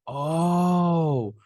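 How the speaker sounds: long, loud, high "oh"; acted-out moment of understanding; dawning realisation. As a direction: let it dawn on you in one drawn-out syllable. Sudden realisation acted in retelling.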